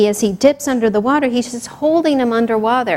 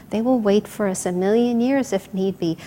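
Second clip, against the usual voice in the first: ordinary delivery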